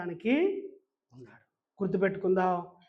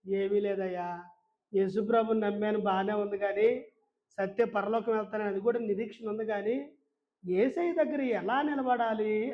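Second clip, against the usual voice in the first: ordinary delivery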